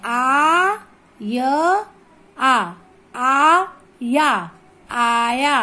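आया read slowly with each आ held long; full long आ. Shown as a demonstration. Teacher showing the pupil not to shorten it, full long आ. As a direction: give every आ in आया its full length. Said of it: "पूरा पढ़ेंगे इसे".

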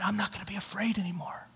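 quick, hushed whisper; barely voiced, reluctant declaration; timid whisper. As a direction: whisper it half-heartedly so nobody wakes. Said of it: "you say it like you mean it".

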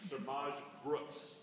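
Semaj Brooks very slow, with crisp consonants; slowly overpronounce Semaj Brooks; enunciation. Shown as a demonstration.

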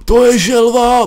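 high, loud gasp of delight; blurting out in wonder; gasping surprise. Real, not acted. Gamer spotting a turtle, high gasping surprise.

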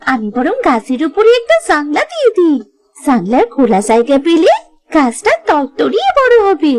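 ordinary talk, nothing marked

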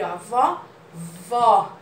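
vó with a wide open ó; open vowel demo. Shown as a demonstration. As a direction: stretch the open ó in vó clearly. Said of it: "Como a gente vê aqui". (vó com ó bem aberto)

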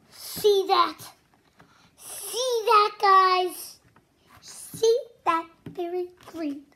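loud, rising thrill; excited. Acted. Loud excited pointing, showing children the green. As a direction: point it out with delight.

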